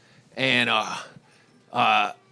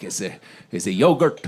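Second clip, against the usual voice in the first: put-on low Indian-accented waiter, dropping lower; imitated Indian accent; 5 semitones lower than usual. Waiter explains the yogurt drink to diner, low Indian accent. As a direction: soothe in a deep mock Indian accent.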